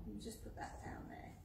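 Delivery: quick, hushed whisper. Real, not acted. Teacher whispering an aside to a child.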